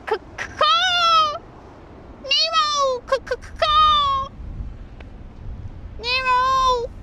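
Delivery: high stuttered, drawn-out gull cries; mock-impaired high bird call; pretend broken-winged bird. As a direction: call pitifully like an injured seagull. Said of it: "I pretend that I have a broken wing".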